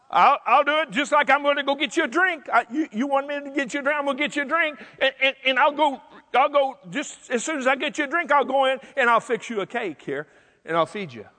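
high, rapid-fire, tumbling willingness; imagined eager-to-please reply; eager compliance. Pretend. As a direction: fall over yourself agreeing to help. Acting out her eager obedient response.

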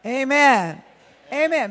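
loud amen. Real, not acted. Preacher calling amen to the congregation, loud. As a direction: call it out to the church.

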